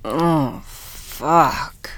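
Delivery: loud moaned curse. Acted. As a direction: groan it out with pleasure. Moaning in pleasure.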